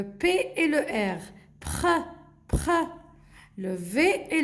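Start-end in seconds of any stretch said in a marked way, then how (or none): 1.6-2.7 "pr" as a short puffed blend; blended consonants. Shown as a demonstration. Teacher repeats for the children, blended consonants. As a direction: sound out "pr", not the letter names. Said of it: "il faut juste savoir comment prononcer".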